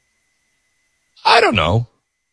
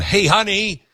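chirpy call; 10 semitones higher than usual. Retold or imitated.

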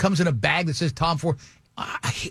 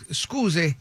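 put-on halting Italian-accented English; slower than usual. Lost Italian driver asking a passerby for help, Italian accent. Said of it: "that is the accent he used".

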